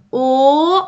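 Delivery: «او» as a long, loud rounded back o; plain O vowel. Shown as a demonstration. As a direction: hold a clear back o on «او». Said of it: "این او بود". («او» کشیده و بلند مثل o انگلیسی)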